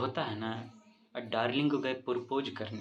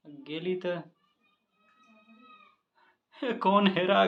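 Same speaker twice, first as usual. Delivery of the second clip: mock rustic lament; 3 semitones higher, 3 dB louder than usual. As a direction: whine the tale like a fussing villager. रोती-कलपती देहाती आवाज़ में किस्सा. (हल्की नकली देहाती रोनी आवाज़)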